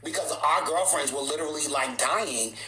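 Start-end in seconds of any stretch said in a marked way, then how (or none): none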